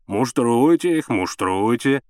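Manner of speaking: slow, drawn-out ribbing; jovial drawl, first word leaned on; teasing father. A narrator's reading. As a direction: egg him on with mock encouragement.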